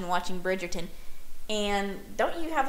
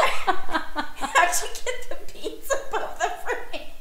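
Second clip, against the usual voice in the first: high, slow words through helpless laughter; real laughing; 11 semitones higher, slower than usual. Real, not acted. Quoting the movie to a friend, high and overcome with laughter. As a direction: struggle to get the line out while cracking up. Quotes movie line while cracking up.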